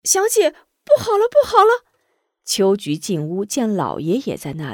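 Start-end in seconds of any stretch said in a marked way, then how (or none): 0.0-1.8 high loud alarmed cry; frantic maid yelling; maid calling out. Acted. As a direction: burst in shouting the news. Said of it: "没等进屋就喊道".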